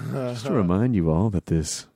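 genteel mock radio announcer, restrained and unhurried; classical station promo. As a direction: promote a truck rally as politely as a concert. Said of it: "a classical station promoting a monster truck rally".

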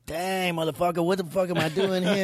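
high-pitched puppy with a mock Mexican accent. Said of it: "He'd be all like".

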